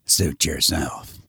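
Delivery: low, slow and terse; a heavy, unbothered official; gruff commissioner. Acted.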